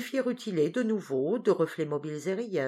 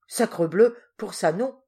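loud startled oath, much louder; alarmed outburst; 3 semitones higher, 7 dB louder than usual. A narrator's reading. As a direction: blurt it out, caught off guard. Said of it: "fit l'apache effaré".

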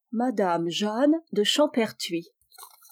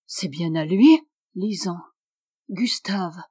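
ordinary talk

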